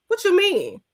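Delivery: high mocking disbelief. Mocking the woman's bragging.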